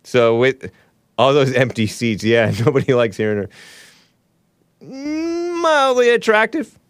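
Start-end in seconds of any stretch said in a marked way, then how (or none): 4.8-6.8 drawn-out high squeak of mock praise; mock swooning falsetto; high slow aside. Sarcastic aside in odd voice.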